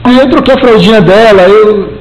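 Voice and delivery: high, pleading mock-tearful protest; retold weeping father. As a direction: whine it high like a dad who can't let go.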